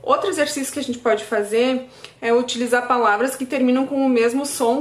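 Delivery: normal talking voice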